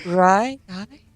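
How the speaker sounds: high, dreamy voice from the past; flashback voice. Pretend. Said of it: "I'm doing flashback voice".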